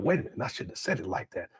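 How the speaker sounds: quietly voiced second thoughts; muttered inner thought. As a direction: think it aloud under the breath. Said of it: "he have to think about".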